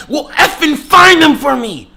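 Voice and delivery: high, loud, drawn-out mock shout; imitating her yelling. Girl ordering him to find her keys, mock yelling. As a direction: screech the order like a furious girl. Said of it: "Like, yelling at me".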